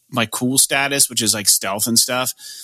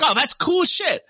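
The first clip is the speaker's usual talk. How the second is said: high, slowed, drawn-out delight; mock excited reaction; slower than usual. Player cheering as the gang runs off, high and slow. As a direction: stretch it out like a thrilled kid.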